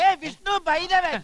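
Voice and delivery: high, loud shout of names; calling out. Acted. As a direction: holler across to friends. Acted calling out to friends.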